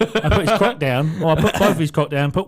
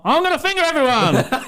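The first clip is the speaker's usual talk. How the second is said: loud, high, laughing blurt; sudden gleeful shout; 12 semitones higher, 5 dB louder than usual. Retold or imitated. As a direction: bellow it out across the room with a laugh. Shouting it out in a pub.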